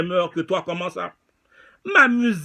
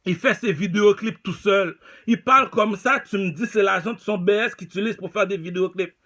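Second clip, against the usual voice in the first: ordinary delivery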